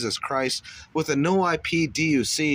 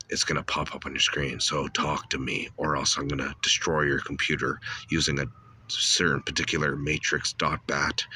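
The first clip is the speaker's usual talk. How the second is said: deep disguised hacker voice; 10 semitones lower, 3 dB quieter than usual. Acted. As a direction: drop the voice way down like a faceless hacker. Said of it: "You talk in your Gnostic voice".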